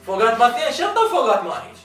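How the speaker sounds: excited arguing. Real, not acted. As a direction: argue it excitedly.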